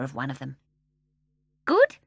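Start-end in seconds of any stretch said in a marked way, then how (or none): 1.6-2.0 very high loud squeak. A narrator's reading. Mrs Corrie asking Michael, squeaky. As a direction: squeak the question.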